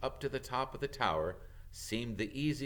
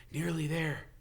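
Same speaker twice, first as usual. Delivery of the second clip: panting; 7 semitones higher than usual. A narrator's reading. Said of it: "Harry panted".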